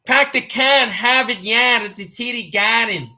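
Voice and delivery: mock Boston accent, dropped r's. Streamer joking about the TD Garden, mock Boston accent. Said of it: "I watched Departed".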